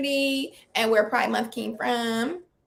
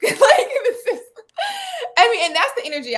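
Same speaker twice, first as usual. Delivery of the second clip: laughing; 13 semitones higher, 6 dB louder than usual. Real, not acted. Laughing while describing the video.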